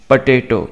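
पोटैटो repeated with a short 'puh' opening; correct potato pronunciation. Shown as a demonstration. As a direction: model पोटैटो as puh-TAY-toh. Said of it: "इस तरह से आपने pronounce करना है". (पोटैटो, सही उच्चारण 'पटेटो')

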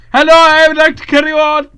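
high, loud put-on Afghan voice; mock Afghan accent. Player demoing an accent for friends, loud high mock Afghan accent. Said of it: "That is Afghan".

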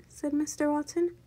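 plain reading voice

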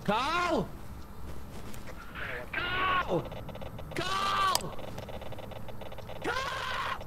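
high, distant calls for Carl; anxious calling. Real, not acted. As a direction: call out for him, rising each time. Calling for missing friend.

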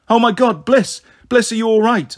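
high, loud cry of alarm; alarmed yell. A narrator's reading. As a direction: yell in panic after a child falls. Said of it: "I yelled".

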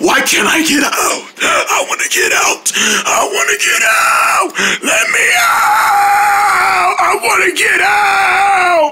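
high, hammed-up crying plea; drawn-out mock sobbing cries; trapped gator wail. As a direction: wail to be let out.

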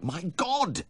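sudden high exclamation; startled, high-pitched outburst; alarmed cry. Acted. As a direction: cry out in horrified realisation. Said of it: "I cried".

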